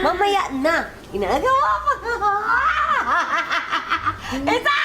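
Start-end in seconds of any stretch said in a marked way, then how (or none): 1.1-1.9 laughing while being tickled; giggling through ticklish squeals. Real, not acted. Mother being tickled by child. 2.0-4.5 high giggling while tickled; helpless ticklish laugh; ticklish laughter. Real, not acted.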